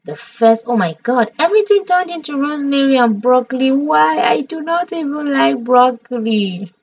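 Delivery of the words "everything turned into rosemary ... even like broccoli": high, wailing complaint; dismayed whine. Real, not acted. Streamer moaning about her broccoli crop, dismayed whine. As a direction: whine it out in one higher run.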